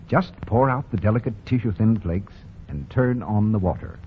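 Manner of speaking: usual speaking voice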